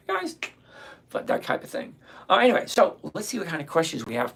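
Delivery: ordinary talk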